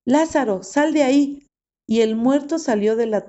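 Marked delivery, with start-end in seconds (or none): 0.1-1.4 a raised, higher call into the tomb; calling out loud. A narrator's reading. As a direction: call him out of the grave, strong and high. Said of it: "Entonces Jesús gritó". (llamada en voz alta hacia la tumba)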